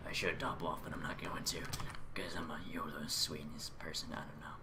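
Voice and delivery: soft whispered asides. Real, not acted. Gamer talking to himself, a whisper. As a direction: half-whisper to yourself. Quiet asides while playing.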